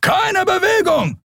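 "Keine Bewegung" yelled very high and slow; shouted order. A narrator's reading. Guard screams at the fugitives to freeze. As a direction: hold each word of "Keine Bewegung" as a drawn-out scream. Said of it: "schrie ein Weißmantel".

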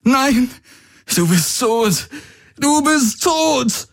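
high, anguished cry building to a shout; tearful screaming. A narrator's reading. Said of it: "weinend und zitternd". (verzweifelt weinend geschrien)

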